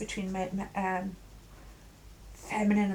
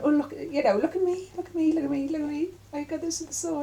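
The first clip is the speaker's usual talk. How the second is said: put-on high, eager look-at-me kid; show-off child voice; 7 semitones higher than usual. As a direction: play a child begging for attention. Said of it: "they're standing there and they're going".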